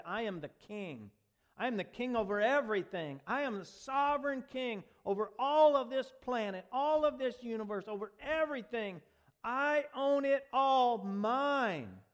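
building from plain to a loud, high proclamation; Jesus declaring he owns it all; voicing the king. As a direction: swell into a triumphant royal claim. Voicing Jesus's kingship claim.

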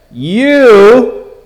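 YOU called out loud and high; booming, drawn-out YOU; emphatic. Acted. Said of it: "it's emphatic".

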